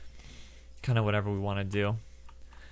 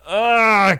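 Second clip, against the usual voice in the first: higher, louder grunting zap noise; laser zap grunt; 10 semitones higher, 12 dB louder than usual. Pretend. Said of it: "I really like making the laser noises".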